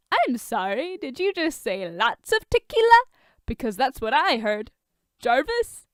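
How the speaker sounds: high, loud teasing fake indignation; Tony gleefully twisting Bruce's words; playful mock mishearing. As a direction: pretend you misheard on purpose.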